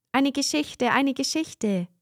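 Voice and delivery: high, eager calling; children begging the storyteller, high and eager. A narrator's reading. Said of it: "riefen die Kinder".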